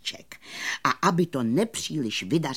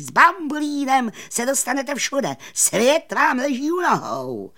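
high, loud grand announcement; showman magician; 10 semitones higher, 6 dB louder than usual. A narrator's reading. Magician inviting friends into his carriage, high loud proclamation. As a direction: proclaim it like a circus barker. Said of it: "zvolal a zamával".

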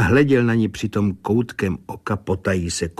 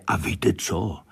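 ordinary delivery

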